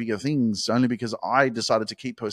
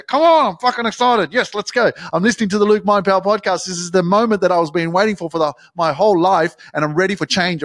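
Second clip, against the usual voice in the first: much higher and louder, pumped-up cheering; excited; 8 semitones higher, 7 dB louder than usual. Pretend. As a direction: yell it out like a fired-up fan, hands in the air. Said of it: "put your hands in the air and go".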